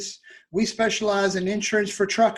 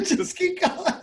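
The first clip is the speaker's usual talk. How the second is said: laughing; 10 semitones higher than usual. Real, not acted. Laughing while urging.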